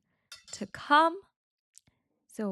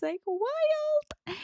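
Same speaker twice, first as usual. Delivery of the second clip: excited squeal. Real, not acted. Teacher exclaims mid-sentence.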